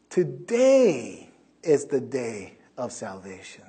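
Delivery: slow, drawn-out proclamation; slow emphasis. Acted. Preacher slowly proclaiming scripture to his listeners. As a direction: lay out every word slowly.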